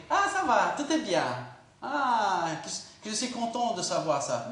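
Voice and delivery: high, breezy mock relief; sing-song mock contentment; carefree mock. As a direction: play the careless optimist who ignores the war. Mock carefree reaction to war.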